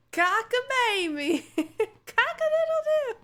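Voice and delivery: high, loud, drawn-out crowing; mock rooster crow. Streamer joking that the word feels like crowing, mock crow. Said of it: "That's what it feels like".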